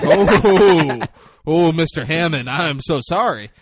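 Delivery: loud, drawn-out "oh" of realisation; hammed-up apologetic gushing; mock fawning apology. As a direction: imitate a sudden grovelling backpedal. Retold gushing apology on recognising Hammond.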